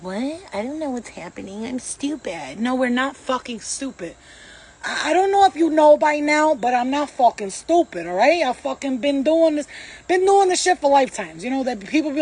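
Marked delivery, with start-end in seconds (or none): 0.0-2.6 soft, dopey pretend confusion; mock clueless voice. Mocking people who want you clueless, soft and dopey. 4.8-11.4 loud, higher, heated insistence; heated rant. Real, not acted. Telling doubters she is not stupid, loud and heated.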